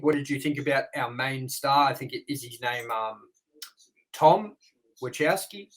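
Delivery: ordinary delivery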